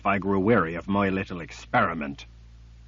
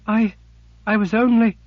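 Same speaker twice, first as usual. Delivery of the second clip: frightened sister voice; 14 semitones higher, 8 dB louder than usual. Acted. Sister falters before glaring master.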